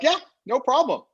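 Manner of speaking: mock eager yes. Phil agreeing to the worldwide deal, high and chirpy. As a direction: imitate a partner overpromising brightly.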